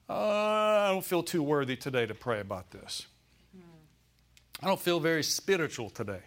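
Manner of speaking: long reluctant "uh" into a put-on moan; mock unworthiness. Preacher voicing the thoughts that hit at prayer time, mock unworthy. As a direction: imitate a hesitant, self-doubting believer. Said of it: "a sense of unworthiness".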